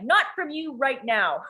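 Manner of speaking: slow, high, emphatic rebuff; retold angry refusal. Mad mother refusing her daughter a hug, slow and high.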